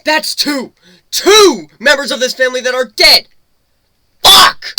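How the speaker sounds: loud, high screaming fury; grief-stricken rage. Acted. Raging over dead family members.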